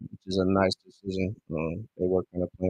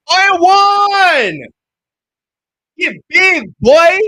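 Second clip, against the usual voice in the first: excited shout; 15 dB louder, much slower than usual. Real, not acted. Fan celebrating a big play.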